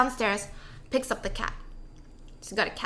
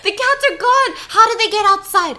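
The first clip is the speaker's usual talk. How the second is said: high, loud, panicked cries; panicked yelling; 9 semitones higher, 8 dB louder than usual. Retold or imitated. Guy finds the cats gone, yelling high in panic. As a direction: yell it in rising panic.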